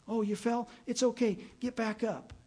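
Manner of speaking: put-on high, coaxing voice lifting a fallen child; encouraging a toddler.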